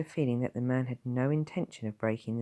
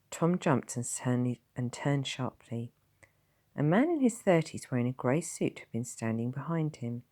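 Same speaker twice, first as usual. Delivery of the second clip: ordinary delivery, nothing marked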